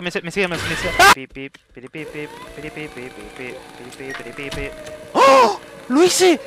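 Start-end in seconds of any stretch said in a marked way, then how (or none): 1.1-4.5 soft, low little beeps; imitated beeping. Imitates beeping while playing. 5.1-6.4 high, loud burst of joy; amazed triumph. Real, not acted. Celebrating a feat in the game.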